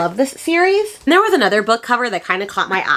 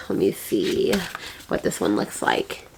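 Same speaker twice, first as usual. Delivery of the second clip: breathy whisper, much softer, to herself; whispered aside; 11 semitones lower, 7 dB quieter than usual. Real, not acted. Checking a book cover, whispering to herself. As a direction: mutter it under your breath as you check the cover.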